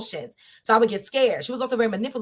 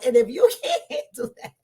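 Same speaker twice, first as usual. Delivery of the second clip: laughing; 11 semitones higher than usual. Real, not acted. Laughing while preaching the point.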